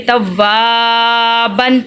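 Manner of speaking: توابن with a long sustained "waa", short "ban"; lengthened middle vowel. Shown as a demonstration. Teacher showing how this word is read, drawn-out middle vowel. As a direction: stretch the middle of توابن, keep the ending short. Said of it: "اسی لیے یہ ہم پڑھیں گے".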